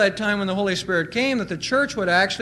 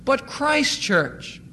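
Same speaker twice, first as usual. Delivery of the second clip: slow emphatic; much slower than usual. Real, not acted. Preacher stresses ownership.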